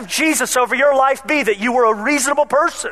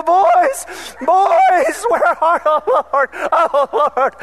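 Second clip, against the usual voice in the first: panicked calling; 6 semitones higher, slower than usual. Retold or imitated. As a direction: call for the kids in rising panic. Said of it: "you could hear the panic in her voice".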